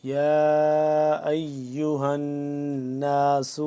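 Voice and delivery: slow, drawn-out chanted tilawat; Quran recitation. Shown as a demonstration. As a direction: recite melodically, stretching every vowel. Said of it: "کھڑا مد زبر".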